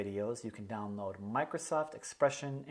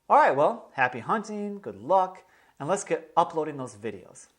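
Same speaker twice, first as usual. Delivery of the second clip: cheery sign-off; 5 semitones higher, 7 dB louder than usual. Real, not acted. Upbeat sign-off.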